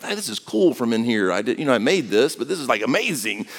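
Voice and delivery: a hint of a wide-eyed unborn Jesus, hushed; baby Jesus in the womb. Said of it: "he's looking around like".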